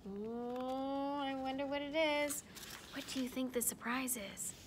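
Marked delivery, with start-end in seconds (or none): none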